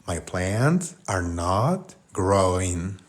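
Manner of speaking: drawn out, word by word; very slow model phrase. Shown as a demonstration. Teacher modelling English for Spanish learners, very slowly. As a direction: spell it out by ear, very slowly.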